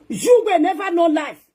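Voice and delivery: slow, high, loud warning; emphatic warning. Acted. Warning the crowd, slow emphatic. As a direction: hammer each word home.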